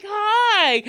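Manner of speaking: imitated loud, very high, stretched-out hello; high-pitched greeting. Imitating the recognized lady greeting her fan, high and loud. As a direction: sing out a mock greeting way up high.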